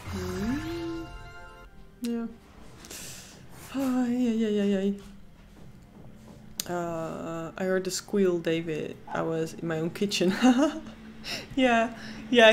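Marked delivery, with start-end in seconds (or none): none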